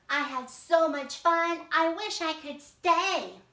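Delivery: wistful alligator. Acted. Alligator tells his Arctic friends he wishes he could stay. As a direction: wish aloud you could stay. Teacher voices Alvaro reading drama.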